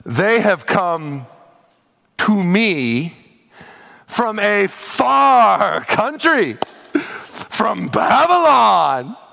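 put-on very slow, drawn-out, proud "from Babylon"; vain Hezekiah. Pastor reads Hezekiah's proud answer to Isaiah, slow and vain. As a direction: savor each word with vanity. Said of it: "You hear it, right? ... the vanity".

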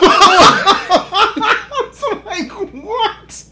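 high, drawn-out laughing what; high, slow incredulous what; stunned what. Retold or imitated. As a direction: stretch it in disbelief. Disbelief retold.

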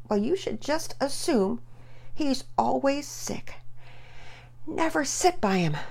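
high, bossy big-sister lecture. Acted. Sister ordering her brother around, bossy and high. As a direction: tell him what to do. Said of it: "Penelope sounded downright bossy".

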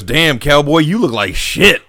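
mocking Shane. Shane mocking Rick in the cop car, lightly voiced cocky drawl. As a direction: tease him like an old buddy. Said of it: "and he goes".

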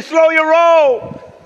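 high, loud, very slow; drawn-out shout. Acted. Congregation told to tell neighbours, drawn-out shout. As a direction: stretch it out at full voice.

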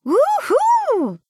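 very high, loud woo-hoo; cheering. Acted. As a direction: whoop like a delighted fan. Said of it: "cheering and clapping".

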